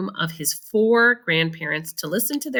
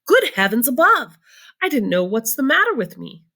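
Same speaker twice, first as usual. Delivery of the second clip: higher, louder cry; shocked exclaim; 6 semitones higher, 3 dB louder than usual. A narrator's reading. Grandpa Joe shocked he never told Charlie, crying out. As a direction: exclaim in dismay.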